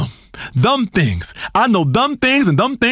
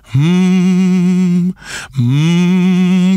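deep hum; 7 semitones lower than usual. Acted. Guru hums on air.

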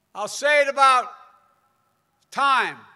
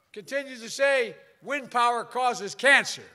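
usual speaking voice

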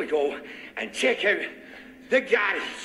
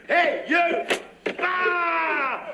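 yelling; 6 semitones higher, much slower than usual. Acted. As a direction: bark at them to stay put. Captor yelling at hostages.

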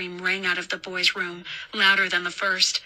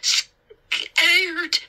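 put-on high child voice, catching on the word; halting, high and fearful; frightened stammer; 10 semitones higher, much slower than usual. A narrator's reading. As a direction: stammer like a scared little boy. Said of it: "he stammered".

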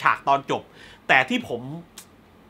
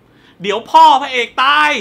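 loud, high, stretched-out mock yell; imitated shout; 6 semitones higher, 8 dB louder, slower than usual. Someone shouting the ending while you watch a film, mock yell. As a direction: holler the spoiler slowly over everyone. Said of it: "มีคนตะโกนตอนจบ".

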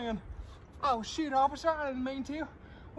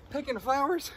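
ordinary talk, nothing marked

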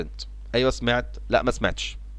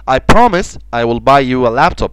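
slow and louder with promise stressed; slow model sentence; 6 dB louder, much slower than usual. Shown as a demonstration. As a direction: read slowly for the class. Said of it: "الكلمة اللي بعد كده promise".

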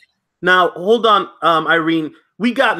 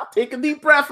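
laughing while telling her to breathe; chuckling teasingly through the words; 6 semitones higher than usual. Real, not acted. Host laughing as he calms Irene, laughing.

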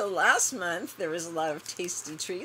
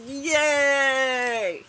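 excited cheer; 5 semitones higher, 8 dB louder than usual. Real, not acted. Excited box opening cheer.

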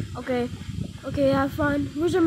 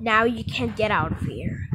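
normal talking voice

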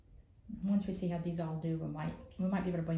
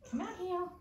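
cat voice; 12 semitones higher than usual. Retold or imitated. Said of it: "She's like".

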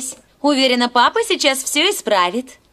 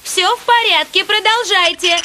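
higher-pitched relayed call; 6 semitones higher than usual. Acted. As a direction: call up to someone on the roof. Relays word up to the roof. (громко кричит наверх)

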